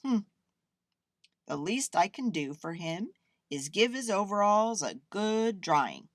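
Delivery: musing artist, thinking aloud; thoughtful. A narrator's reading. Artist decides to help the damp bear, thoughtful. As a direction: think it over aloud, stressing the last words. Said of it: "the man said thoughtfully".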